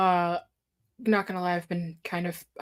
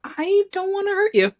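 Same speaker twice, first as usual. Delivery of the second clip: higher, louder teasing echo of the line; playful mock echo; 12 semitones higher, 5 dB louder than usual. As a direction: toss the line back, teasing. Player echoes Darcy's line mockingly.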